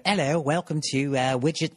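a lightly put-on British accent, a receptionist greeting; half-hearted posh British phone greeter; bad British accent. British accent as a fake business line's receptionist answering callers. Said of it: "I'm doing a bad accent".

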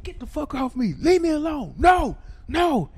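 high, loud yelling to be let go; panicked shouting. Retold or imitated. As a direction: shove them off with your voice. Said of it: "And I'm like".

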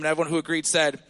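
ordinary talk, nothing marked

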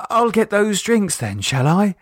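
high, forced-bright offer; cheerier tone. A narrator's reading. Michelle covers her shock offering drinks, forced cheer. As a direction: paper over the shock with cheer. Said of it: "continued in a cheerier tone".

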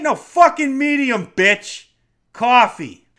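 rude, forceful outburst; aggressive order. Shown as a demonstration. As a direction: snap the order at the barista. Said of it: "you don't have to say it like that".

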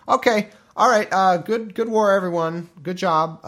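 acted hearty, awkward farewell; dismissing the troops. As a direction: wrap it up loudly for the whole crowd.

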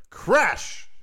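loud, high sound effect crash. Acted. Millstone falls on wife.

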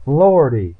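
loud, high gasp of amazement; high-pitched, startled exclamation. A narrator's reading. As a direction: gasp it out, astonished. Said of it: "she gasped".

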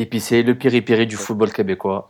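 grand announcer intro. Acted. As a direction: introduce the guest like a boxer. (présentation grandiose du co-animateur)

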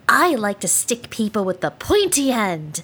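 put-on a creepy child. Creepy child character appears.

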